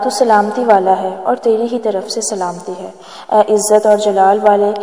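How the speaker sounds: ordinary talk, nothing marked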